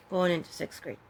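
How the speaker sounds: normal talking voice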